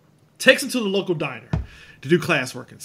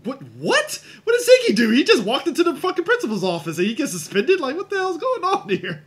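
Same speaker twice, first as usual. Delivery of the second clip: much higher, laughing in disbelief; incredulous disbelief; 9 semitones higher than usual. Retold or imitated. Reacting to Ziggy's suspension, a high laughing disbelief. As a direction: squeal it like you can't believe it. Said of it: "I'm like".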